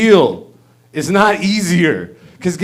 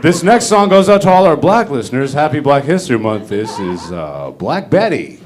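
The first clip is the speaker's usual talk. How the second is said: low, mumbling country DJ; yokel radio DJ; 7 semitones lower than usual. Retold or imitated. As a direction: do a sleepy small-town radio host, much lower. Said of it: "It's like".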